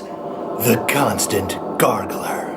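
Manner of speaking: breathy slow movie-trailer whisper; whispered promo. Acted. Host whispering a spoof movie plug to viewers, slow whisper. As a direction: hush it like a secret teaser to viewers.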